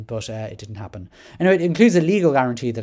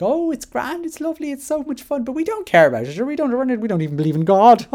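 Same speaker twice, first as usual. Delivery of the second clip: much higher, chirpy mock of her Irish accent; 13 semitones higher, 5 dB louder than usual. Mimicking the interviewed parent in her Irish accent, higher and mocking. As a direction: send up the breezy Irish parent voice, bubbly and high. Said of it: "sorry for taking off her accent".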